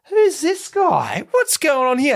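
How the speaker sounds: hammed-up puzzled inner voice; baffled. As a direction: play up total confusion. Baffled thought mid-race.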